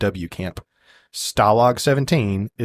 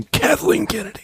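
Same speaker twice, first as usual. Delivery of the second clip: blurted name. Acted. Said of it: "Sorry. Did I say that out loud?".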